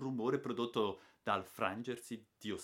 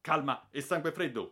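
loud, higher call for calm; shouted command; 6 semitones higher, 6 dB louder than usual. Acted. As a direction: bark the order to stay steady.

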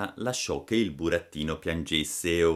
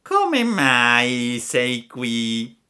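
very slow, drawn-out fox voice; sly fox; 6 semitones higher, 9 dB louder, much slower than usual. Acted. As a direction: stretch every word like a cunning fox. Ripeté il gatto. (volpe astuta, voce lentissima)

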